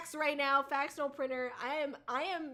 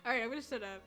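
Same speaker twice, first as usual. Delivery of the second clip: ordinary talk, nothing marked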